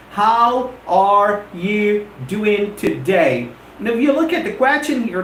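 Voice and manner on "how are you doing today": slow, each word set apart. Shown as a demonstration. English teacher giving learners an example, word by word. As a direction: say every word separately, unhurried. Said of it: "For instance".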